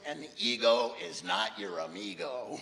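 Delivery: low, loud, slow put-on voice; parolee. Parolee answers his teacher, slow low mimicry. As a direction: imitate a parolee, slow and emphatic. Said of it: "one of them goes".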